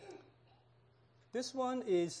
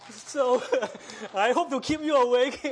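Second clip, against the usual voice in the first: loud, bursting laughter between words; laughing aloud; 6 semitones higher, 9 dB louder than usual. Real, not acted. Preacher teasing the congregation about sleeping, laughing loudly. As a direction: crack up while teasing the congregation. Laughing after the anesthesiologist joke.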